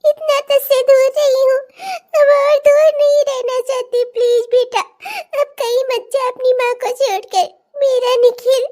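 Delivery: high, crying plea; mother weeping for her son; tearful. Acted. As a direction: sob it out, begging him to stay. Mother weeps, recognising son.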